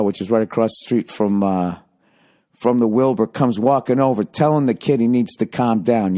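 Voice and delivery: usual speaking voice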